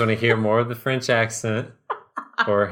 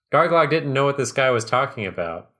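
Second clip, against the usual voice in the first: usual speaking voice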